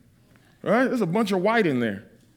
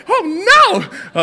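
high, louder hammed-up wail; hammed-up horrified oh no; mock dismay; 13 semitones higher, 7 dB louder than usual.

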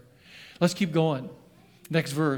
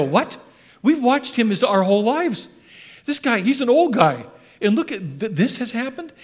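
loud, high amazed bystanders; astonished crowd; 5 semitones higher, 4 dB louder than usual. Retold or imitated. Townspeople shocked to see the lame man walking, loud amazed cry. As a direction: gasp it out like you can't believe your eyes. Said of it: "people saw him and they go".

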